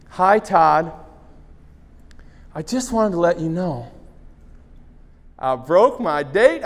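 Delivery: a hint of the girl giving in; lightly voiced Tracy. Tracy gives in.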